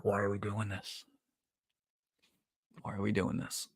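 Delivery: very soft, under-the-breath dismay; dismayed muttering. Real, not acted. Despairs at the scene he mocks.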